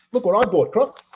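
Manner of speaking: teasing friend. Retold or imitated. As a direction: crow it like a prankster showing off. Friend gloating over prank, retold.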